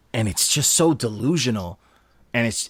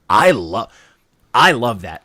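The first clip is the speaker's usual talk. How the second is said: ordinary talk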